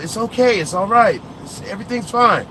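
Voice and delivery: a hint of the polite reassuring voice; non-threatening voice. Shown as a demonstration. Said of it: "give that non-threatening aura off".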